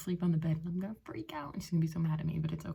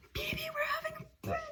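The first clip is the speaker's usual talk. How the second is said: very high, fast excited yell; joyful shout; much faster than usual. Real, not acted. Calling to the dog B B, joyful high shout. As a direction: burst out the news.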